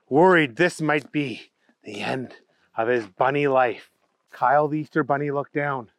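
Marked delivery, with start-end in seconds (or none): none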